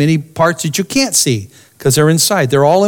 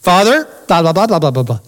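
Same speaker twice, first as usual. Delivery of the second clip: "Father" higher then droned blah blah; formal droning Father; 3 semitones higher, faster than usual. Shown as a demonstration. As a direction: mimic a stiff distant address. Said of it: "think of the intonation".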